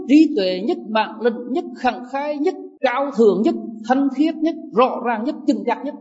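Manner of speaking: high, rising, emphatic preaching; impassioned preaching. Acted. Monk rousing the assembly about keeping precepts, soaring and emphatic.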